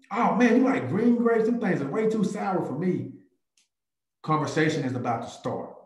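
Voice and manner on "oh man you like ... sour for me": high, loud chummy opener; friendly grocery banter. Retold or imitated. Agent teasing a stranger over grapes, high and chummy. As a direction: strike up chat like an old pal. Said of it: "And I'm gonna ask them".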